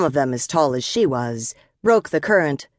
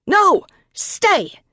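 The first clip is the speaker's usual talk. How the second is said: high, urgent yelled command; 4 dB louder than usual. A narrator's reading. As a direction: yell sharply to hold the dog back. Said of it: "and again Kate yelled".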